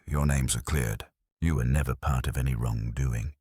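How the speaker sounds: plain reading voice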